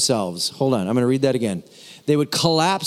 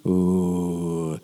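a low, soft "ooh" of self-approval, far deeper; pleased low ooh; 10 semitones lower, 4 dB quieter than usual. Real, not acted. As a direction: savour it with a deep "ooh". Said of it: "that was pretty good".

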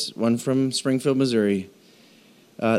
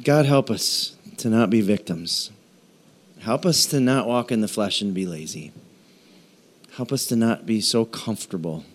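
closing prayer; 3 semitones lower than usual. Acted.

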